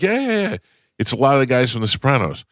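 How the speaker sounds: very high, excited mock reassurance; hammed-up eager friend; imitating Vinny Pastore. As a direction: rattle off the yeahs way up high.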